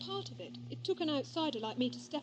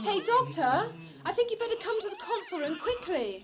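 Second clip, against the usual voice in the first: calling out; 8 semitones higher, 5 dB louder than usual. Acted. Rhea calling Doctor urgently.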